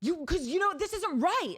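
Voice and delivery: high, loud, slow mock yell; hammed-up irate driver yelling; retold angry yelling. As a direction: imitate the woman shouting back from her car. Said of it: "she kept yelling at me".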